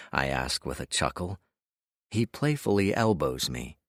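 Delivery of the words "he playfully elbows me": plain reading voice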